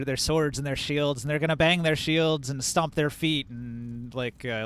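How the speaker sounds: usual speaking voice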